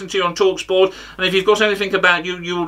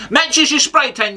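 higher, drawn-out taunt in character; mock outburst; 7 semitones higher, slower than usual. Comic mocking the pundit's tan, higher drawn-out taunt. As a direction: burst upward into a comic jibe. Mock insult within the impression.

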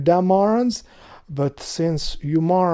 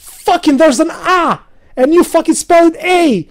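loud, much higher frustrated rant; angry rant; 8 semitones higher, 5 dB louder than usual. Real, not acted. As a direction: rage at the spelling. Ranting about English spelling.

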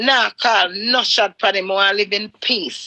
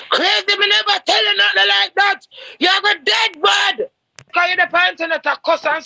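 angry shout; 9 semitones higher than usual. Retold or imitated. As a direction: shout him down in a rage. Retold quarrel with ex, shouting back.

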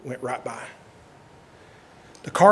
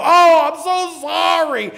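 mock apology; 10 semitones higher, 5 dB louder, slower than usual. Mocking a liar's apology.

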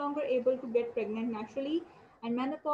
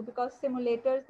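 ordinary talk, nothing marked

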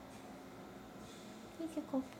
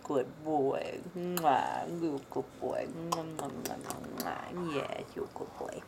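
talking to a dog; 8 semitones lower, slower than usual. Real, not acted. Kissing puppy, low coo.

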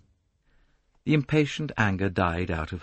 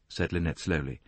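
ordinary delivery

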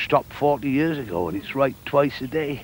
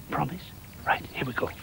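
whispering; 11 dB quieter than usual. Acted. Whispering before the hand trick.

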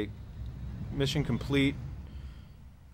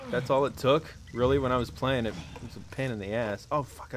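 normal talking voice

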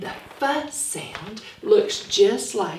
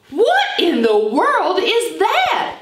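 higher, louder surprised question; curious fox; 9 semitones higher, 4 dB louder than usual. Acted. Fanny Fox asking Hi-Hat about the gadget, surprised. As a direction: ask it like an amazed little fox. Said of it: "asked Fanny Fox".

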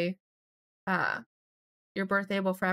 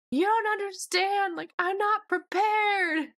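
much higher, slow, whiny mock panic; mock freaking out; 11 semitones higher, 4 dB louder, slower than usual. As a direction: wail it like a hammed-up panicking mom. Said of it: "She's like".

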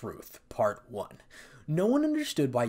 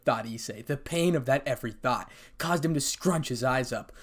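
usual speaking voice

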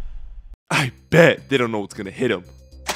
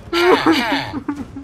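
mocking laugh; 10 semitones higher than usual. Forcing rival to jump.

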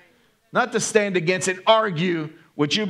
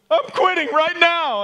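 much higher, louder, slow mock-weeping; imitated tearful outburst; 13 semitones higher, 6 dB louder than usual. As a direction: wail it like a caller crying his heart out. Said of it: "he started crying on the phone".

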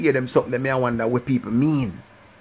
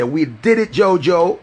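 higher and louder, Jojo lifted at the end; a bright rising hail of "Jojo"; excited catchphrase; 6 semitones higher, 6 dB louder, slower than usual. Shown as a demonstration. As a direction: call out We did it, Jojo with a rising Jojo. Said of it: "you go hear me say".

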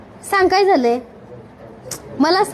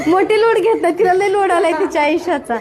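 amused chuckle while talking; teasing laughter; laughing. Real, not acted. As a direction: laugh through the words fondly. Vlogger laughs at child's worries.

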